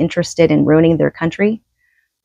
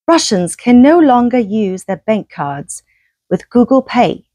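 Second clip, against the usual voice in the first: reading in a light put-on English accent; 2 semitones higher than usual. Reading an English news report aloud in an English accent. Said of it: "That was my, my English accent".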